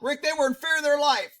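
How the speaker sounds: high, quick mock protest; mocking objectors. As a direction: mimic an excuse-maker, high and hurried. Mimics viewers excusing the officers.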